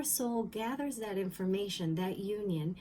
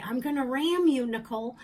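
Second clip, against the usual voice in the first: loud, higher mock-threat from mom; mom's saying; 4 semitones higher, 8 dB louder than usual. Mom joking around with us kids, playful loud threat. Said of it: "she used to say".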